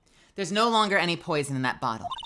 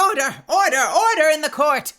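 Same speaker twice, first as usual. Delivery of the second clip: loud, higher commanding shout; banging for order; 10 semitones higher, 9 dB louder than usual. Acted. Judge silencing the courtroom, shouting. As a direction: restore order in the room at full volume.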